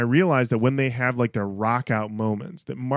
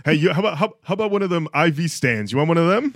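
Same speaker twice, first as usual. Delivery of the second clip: higher, louder shady dealer pitch; mock salesman; 5 semitones higher, 4 dB louder, faster than usual. Supply guy offering IV stands, hammed-up shady salesman.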